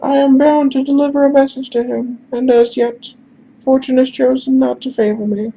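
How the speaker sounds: formal falsetto lament; high-pitched character voice. Acted. Messenger explains his failed errand, high-pitched voice. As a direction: explain your bad luck in a very high voice. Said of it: "we're just gonna do high-pitched instead".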